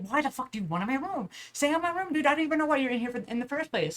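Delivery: high-pitched, exasperated retort; retold indignation. Acted quarrel with brother over room.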